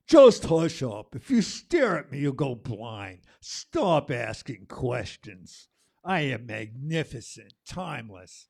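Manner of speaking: loud, slow and lordly put-down; haughty sun. Acted. The sun scolding the questioner, loud, slow and grand. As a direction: boom it out like a vain sun.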